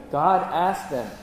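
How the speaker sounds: ordinary delivery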